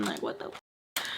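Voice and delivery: breathy whispered what the f-; whispered curse. Retold or imitated. Frustrated woman whispering to herself. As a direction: hush it under your breath.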